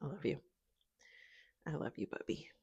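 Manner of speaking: soft, fond murmur to the dog; tender to dog. Real, not acted. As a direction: coo it softly to your pet. Talking tenderly to her dog.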